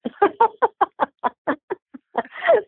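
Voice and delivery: long, high-pitched peal of laughter; hearty laugh. Real, not acted. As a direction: burst out laughing at the punchline. Amused by the child's remark.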